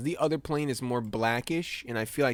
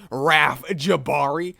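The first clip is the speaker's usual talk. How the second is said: mock scolding; 8 dB louder, much slower than usual.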